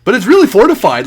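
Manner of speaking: quick, loud and bright; excited. Real, not acted. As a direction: burst out with excitement. GM excited about fortifications.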